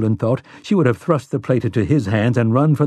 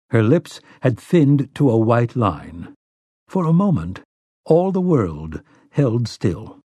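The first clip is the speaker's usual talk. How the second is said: usual speaking voice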